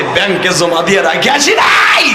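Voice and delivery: defiant retort. Acted. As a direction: stretch it out, building toward a shout. First take of retold retort. (টেনে টেনে চড়া গলায় জবাব)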